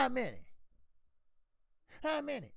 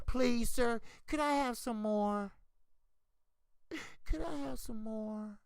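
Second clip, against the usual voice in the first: high, pleading orphan voice; mock beggar; 6 semitones higher than usual. Fighter mockingly begging the promoters for a deal. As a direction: whine it like a hungry orphan asking for food.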